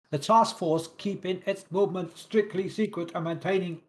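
hammed-up German accent, almost robotic; mock German accent. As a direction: read the order in a jokey German accent. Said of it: "I'm doing a German accent for Japan".

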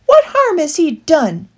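high, loud demand. A narrator's reading. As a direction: insist on an answer. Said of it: "he demanded".

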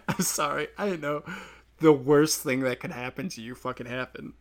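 ordinary talk, nothing marked

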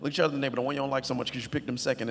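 ordinary delivery

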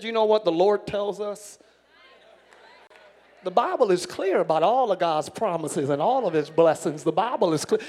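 low, unhurried country preacher voice, lightly done; a hint of a chill country preacher; laid-back country preacher. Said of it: "He talked just like that".

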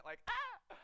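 high, loud mock scream. Inner voice recalling the Russian Doll scene, mock scream. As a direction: yelp like the window falling. Imitating a falling window unit.

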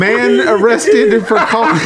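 slow, high, breaking into laughter; trying to read through giggles; laughing while reading. Real, not acted.

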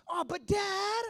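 put-on high, drawn-out childish protest; imitating whining son. Son told no by his father, mock whining protest. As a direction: whine it up high like a thwarted kid.

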